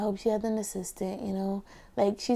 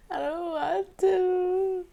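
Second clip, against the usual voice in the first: put-on high, very slow, teary kid's whine; mock whining; 9 semitones higher, much slower than usual. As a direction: plead like a child close to tears. Refusing the tea as a child.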